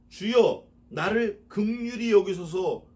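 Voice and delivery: slow pleading psalm line; fervent verse reading. Acted. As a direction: beg for mercy in the verse. Reads verse with fervor.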